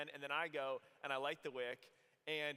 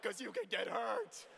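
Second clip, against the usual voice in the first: louder, slower teasing dude voice; rowdy guys; 5 semitones higher, 5 dB louder than usual. Retold or imitated. Boys at the pool party urging the jump, louder and slower. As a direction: egg him on like a gleeful bro. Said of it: "We're like".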